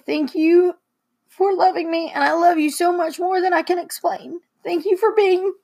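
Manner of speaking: tearful, lifted voice; trying not to cry. Real, not acted. Daughter telling Mom she loves her, fighting tears. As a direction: push the loving words through tears. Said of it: "I'm trying not to cry".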